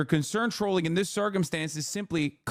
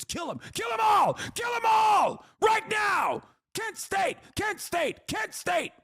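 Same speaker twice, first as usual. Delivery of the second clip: high, shrill-pitched mock chant shouting for violence; mock bloodthirsty shout. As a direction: scream it like a parody of a crazed pundit. Parodying bloodthirsty pundit chant.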